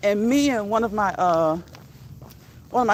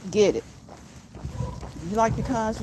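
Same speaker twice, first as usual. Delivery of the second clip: ordinary talk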